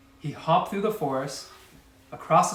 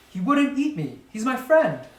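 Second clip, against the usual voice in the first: put-on higher, louder child's protest; pleading protest; 9 semitones higher, 5 dB louder than usual. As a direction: insist desperately.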